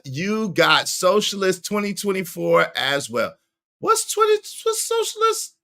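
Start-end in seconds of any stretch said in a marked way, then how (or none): 3.8-5.6 squeaky falsetto question; mock puzzlement. Streamer questioning a chat comment, puzzled falsetto. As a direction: ask it way up high, baffled.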